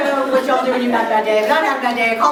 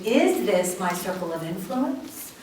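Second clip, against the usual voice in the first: soft self-questioning; 8 semitones lower, 10 dB quieter than usual. Shown as a demonstration. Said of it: "we're gonna ask the question".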